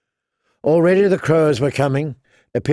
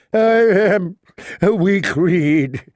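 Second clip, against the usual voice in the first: high, drawn-out tearful plea; weeping plea; 5 semitones higher, much slower than usual. A narrator's reading. Septon confesses to Lord Beric at his trial, weeping. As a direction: plead slowly as if weeping. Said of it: "The Septon wept and prayed".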